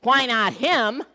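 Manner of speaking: loud, pleading why me; a man protesting his fate; complaining man. Retold or imitated. Preacher voicing a man crying out why me.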